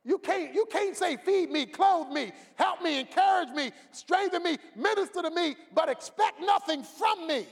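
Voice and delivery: loud, high preacher's cry; preaching at full force. Acted. Pastor shouting demands of the selfish member. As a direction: drive it home to the church.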